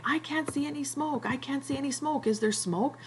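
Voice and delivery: high, worried Piggy repeating himself; mild mock yell. Piggy calling to Ralph about smoke, high mock panic. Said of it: "And Piggy's yelling".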